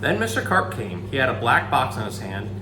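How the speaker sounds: usual speaking voice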